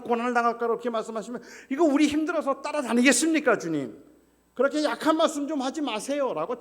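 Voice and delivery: loud, high indignant scolding; scolding rebuke. Acted. Peter rebuking Jesus, loud indignant scolding. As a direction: tell him off hard, voice up and loud. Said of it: "책망했을 겁니다".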